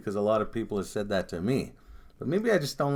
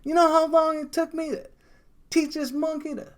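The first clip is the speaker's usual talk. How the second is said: much higher, far-off comic character voice; Dave Chappelle impression; 7 dB louder, slower than usual. Retelling Chappelle's monkey bit to a friend, high put-on voice. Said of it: "the fucking monkey bit".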